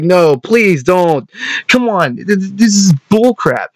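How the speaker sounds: high, slow, strained whine of protest; exasperated pleading protest. Retold or imitated. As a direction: beg him to stop, stammering with frustration. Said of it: "I was getting mad".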